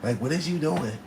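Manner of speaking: put-on high, scolding girlfriend; mock the nagging girlfriend; lightly voiced angry girlfriend. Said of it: "your bitch is like".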